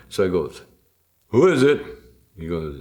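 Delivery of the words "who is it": loud, high call at the door. Retold or imitated. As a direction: shout through the door. Calling through the door.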